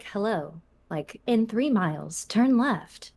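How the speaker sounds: synthetic virtual assistant voice; slow, measured navigation-assistant delivery; Siri Alexa. Shown as a demonstration. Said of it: "like, Siri Alexa impression".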